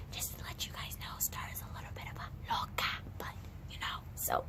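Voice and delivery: hushed secret whisper. Acted. Telling viewers a secret about Starla, whispered. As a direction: whisper it behind her back.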